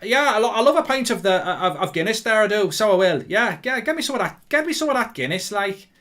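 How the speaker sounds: loud, high-pitched mock Irish accent; exaggerated Irish accent slipping into Scouse. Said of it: "doesn't have an Irish accent".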